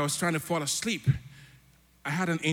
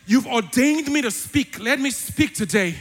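louder, higher fiery prayer that casts out spirits; preaching at full force; 5 semitones higher, 4 dB louder than usual. Real, not acted. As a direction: declare it with rising fervour over the music. Prays loudly against spirits.

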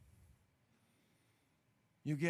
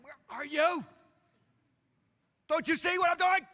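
much higher and louder, exasperated; frustrated cry; 10 semitones higher, 5 dB louder than usual. Acted. Preacher voicing a believer frustrated with God, high and loud. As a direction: demand an answer, fed up.

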